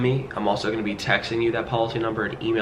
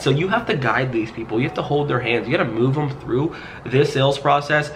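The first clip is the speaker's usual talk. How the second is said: usual speaking voice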